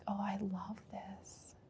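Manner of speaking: very soft, near-whispered delight; barely voiced, softly savoured; hushed inner voice. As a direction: murmur it as a private thought.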